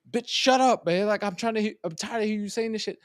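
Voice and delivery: high-pitched mock outburst at a sibling; retold exasperated protest. Fallen brother answering his scolding sister, mock exasperation. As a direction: act out a fed-up brother, voice way up.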